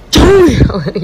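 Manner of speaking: loud, high "Trời ơi"; loud exclamation. Real, not acted. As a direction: exclaim in mock shock. Exclaims at long leg. (kêu lên thảng thốt)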